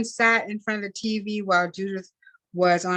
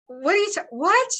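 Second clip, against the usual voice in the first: sudden high, loud cry of disbelief; the news hits her, cry out and stammer; shocked disbelief; 12 semitones higher, 3 dB louder than usual. A narrator's reading. Grandmother hearing the plane vanished, crying out in shock. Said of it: "shook her head in disbelief".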